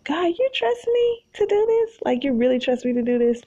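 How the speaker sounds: high, amazed question, quick at the end; amazed prayer to God. Retold or imitated. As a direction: ask God in disbelief, voice jumping up. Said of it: "I'm just like".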